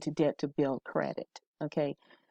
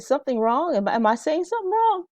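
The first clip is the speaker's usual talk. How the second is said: high, anxious checking in; a teacher's high, quick concern; worried question; 11 semitones higher, 3 dB louder, faster than usual. Retold or imitated. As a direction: ask if you've said something wrong, pitched high. Teacher asks an upset student.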